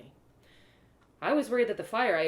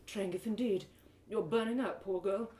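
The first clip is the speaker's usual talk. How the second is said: gentle healer voice; 2 semitones higher than usual. Acted. Iktuin voiced, tending narrator.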